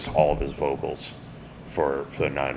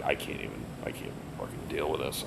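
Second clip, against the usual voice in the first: soft, fast exasperated mutter; exasperated under breath; 2 semitones lower, 14 dB quieter, faster than usual. Retold or imitated. Excusing himself from the drunk session, muttered exasperation. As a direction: mutter it fast, fed up, half to yourself. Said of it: "I'm like".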